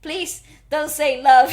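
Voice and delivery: loud, slow, insistent begging. Retold or imitated. Retelling dread at the coming line, loud insistent plea. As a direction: beg it out word by word. Same plea repeated louder.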